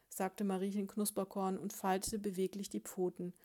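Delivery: plain reading voice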